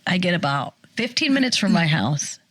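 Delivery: usual speaking voice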